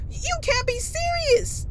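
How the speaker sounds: high, incredulous cry; can't believe what he hears; shocked disbelief. Acted. Disbelief at pilots knocked out.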